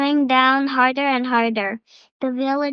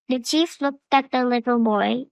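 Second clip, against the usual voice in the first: ordinary delivery, nothing marked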